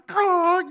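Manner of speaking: high loud bird screech; hawk caw. Retold or imitated. Pastor imitates the hawk grabbing the bird, loud high caw. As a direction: squawk like a diving hawk.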